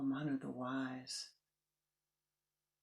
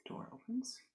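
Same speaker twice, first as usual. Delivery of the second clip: ordinary delivery, nothing marked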